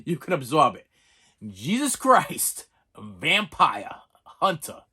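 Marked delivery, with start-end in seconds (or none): 1.4-4.8 slow, drawn-out title; slow title repeat. Shown as a demonstration. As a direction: let each word sink in slowly. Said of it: "let me say it one more time".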